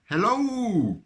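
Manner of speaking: loud high call down the well. A narrator's reading. Park keeper calling down to the lost rabbit. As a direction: call out into the dark hole. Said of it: "called Percy".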